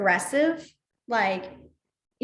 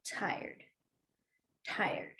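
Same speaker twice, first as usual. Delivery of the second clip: whispered tired; 4 semitones lower, 12 dB quieter than usual. Shown as a demonstration. Signing tired, trailing off.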